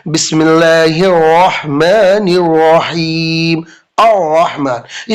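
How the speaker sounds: बिस्मिल्लाहिर्रहमानिर्रहीम with every r full and rolled; slow, roll each r in बिस्मिल्लाहिर्रहमानिर्रहीम; full heavy r. Shown as a demonstration. Teacher shows students the heavy rolled r. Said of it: "आर का जो pronunciation होता है ना, वो बहुत पुर करके".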